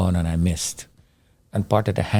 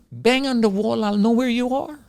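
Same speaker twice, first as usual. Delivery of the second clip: retold screaming; 12 semitones higher than usual. Rescuer calls to trapped man behind wall, mock high yell. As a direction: imitate a man yelling through a wall, pitched way up. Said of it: "he's screaming".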